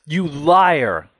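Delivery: loud, high, tearful accusation; furious shout. A narrator's reading. Son screaming at his father through tears, furious shout. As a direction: hurl LIAR at him with all your rage. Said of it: "I cry".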